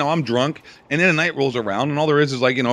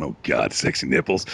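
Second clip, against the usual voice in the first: mock sultry; 11 semitones lower than usual. Mock self-seduction in mirror.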